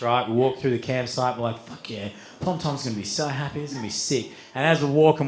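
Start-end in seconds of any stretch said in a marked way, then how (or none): 1.7-4.3 retold quiet delight. Friends pleased, walking back with weed.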